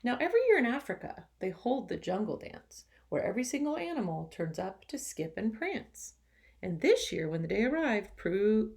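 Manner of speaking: lively, expressive storybook reading; reading to children. A narrator's reading. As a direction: read it to the class with energy. Teacher reads picture book aloud.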